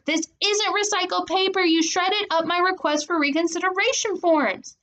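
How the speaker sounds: high, outraged accusation; furious scolding. A narrator's reading. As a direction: lay into your little sister. Furious at sister who shredded forms.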